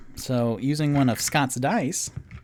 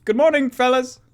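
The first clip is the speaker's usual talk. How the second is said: loud greeting; 12 semitones higher, 8 dB louder, faster than usual. Acted.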